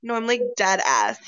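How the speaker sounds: usual speaking voice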